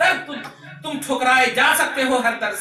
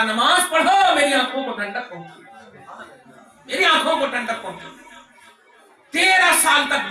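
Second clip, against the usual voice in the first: impassioned, raised sermon voice; preaching at full force; 5 semitones higher, 5 dB louder than usual. Real, not acted. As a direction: proclaim it to the congregation. (जोश भरी तक़रीर)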